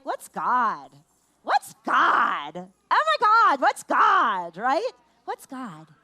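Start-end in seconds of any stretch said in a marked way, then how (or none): 0.0-4.6 hammed-up puzzled outburst; mock exasperated asking. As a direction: pester the question like an impatient kid. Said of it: "what we can ask our kids right now is, like".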